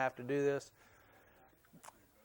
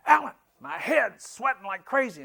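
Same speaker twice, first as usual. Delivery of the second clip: put-on higher, louder old man's voice, amazed; imitating his dad; 9 semitones higher, 8 dB louder than usual. Son voicing his ninety-two-year-old dad mid-roller, higher and louder. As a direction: play the startled old father, pitched up and loud. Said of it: "all for a while he goes".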